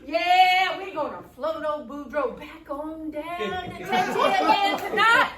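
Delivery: loud, building whoop of a sheriff; excited. Acted. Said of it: "he was so excited".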